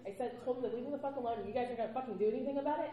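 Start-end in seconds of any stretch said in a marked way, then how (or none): none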